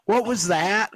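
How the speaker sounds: high, loud, alarmed "what was that"; startled question. Retold or imitated. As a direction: girlfriend jumps at the loud pop. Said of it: "my girlfriend's like".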